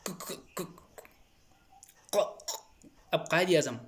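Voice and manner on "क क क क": stuck stammer on a hard k, breathy and soundless; stammering block. Shown as a demonstration. Speaker showing an audience how he got stuck on Quaid-e-Azam, stammering. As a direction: catch repeatedly on the k sound without getting the word out. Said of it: "जब अटक जाता था तो बिल्कुल".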